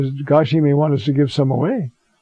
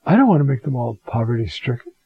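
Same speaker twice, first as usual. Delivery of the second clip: calm, clear voice; 4 semitones lower than usual. Retold or imitated. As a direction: soothe him with a hushed "Look". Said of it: "the calmest, clearest voice".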